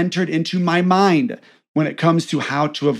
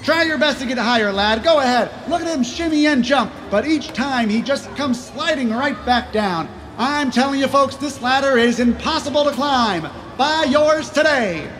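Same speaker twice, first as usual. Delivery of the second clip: high-pitched carnival barker pitch; snake oil seller; 7 semitones higher, 3 dB quieter than usual. Acted. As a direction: hawk it to the crowd. Carnival pitchman selling ladder.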